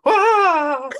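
high, loud mock old-lady wail; hammed-up high-pitched scream. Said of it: "like, an old lady scream".

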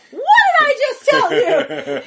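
very high, loud, laughing scolding warble; high playful old-lady telling-off; Julia Child. Retold or imitated.

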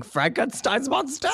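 high-pitched acted yell; babysitter's rising, hammed-up cry. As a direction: call out after a runaway monster.

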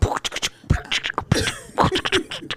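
quick clicking beatbox sounds; beatbox noises. Pretend. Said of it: "Is that your beatboxing?".

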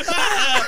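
high, loud mock shriek of shock; startled scream. Neighbor under the collapsing ceiling, a startled shriek. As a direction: scream as water crashes down on you.

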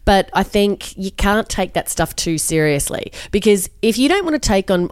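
ordinary talk

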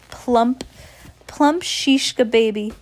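slow, high, teasing exclamation; exclaiming. Acted. Witch poking a squealing girl, exclaiming. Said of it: "she exclaimed".

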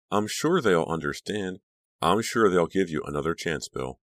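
ordinary delivery, nothing marked

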